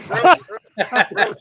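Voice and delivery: calling out. Real, not acted. Calling out to get attention.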